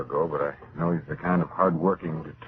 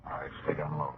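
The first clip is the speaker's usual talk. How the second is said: hushed warning; 4 semitones lower, 11 dB quieter than usual. Acted. Hiding from a sniper.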